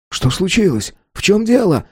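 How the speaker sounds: high-pitched startled crowd voices; alarmed questions. A narrator's reading. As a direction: call out in anxious surprise.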